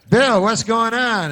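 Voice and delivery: mock anchor calling out to Bill; news anchor. Anchor calls field reporter.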